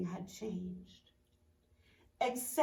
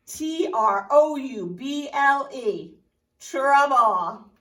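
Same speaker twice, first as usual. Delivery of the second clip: slow, loud spelling T R O U B L E then trouble; spelled for emphasis; 2 semitones higher, 10 dB louder, slower than usual. Shown as a demonstration. Reading to children, spelling trouble slow and loud. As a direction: spell it out big and slow for the kids.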